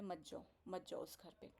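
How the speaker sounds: softly imitated guru's warning, low and hushed; low, quiet inner voice urging her; voice in the ear. Guru speaks in her ear, warning against the house, hushed. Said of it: "गुरुदेव मेरे कान में बोले".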